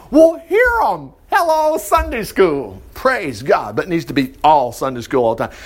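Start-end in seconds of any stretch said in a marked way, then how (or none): 0.1-2.8 very high and slow Hello; high, drawn-out exclamation. Real, not acted. Preacher calling out about Sunday school, high and slow. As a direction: stretch Hello way up.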